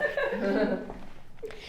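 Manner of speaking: quiet, breath-only nervous laugh. Real, not acted. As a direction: laugh softly out of sheer nerves. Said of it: "c'est des rires de nerfs". (rire nerveux)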